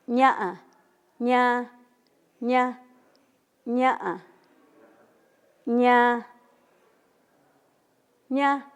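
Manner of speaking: "ña" repetido con distintos tonos; varía el tono en cada "ña"; tonos mixtecos. Shown as a demonstration. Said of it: "yo digo". (demostración de tonos con "ña")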